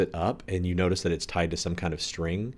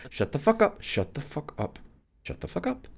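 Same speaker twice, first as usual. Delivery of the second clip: high-pitched, exasperated repeats; exasperated silencing; 9 semitones higher than usual. Acted. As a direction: hush them frantically, voice jumping up high. Same character still silencing callers.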